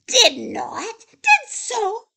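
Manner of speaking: loud, high, rapid-rising squabble; bickering shouts. A narrator's reading. Two animals squabble over a nut, high shouts.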